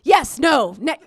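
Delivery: loud, stammering outbursts; punchy, broken-off shouts; flustered panic. Acted. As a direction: blurt contradictory answers in mock alarm. Flustered answer to son's question.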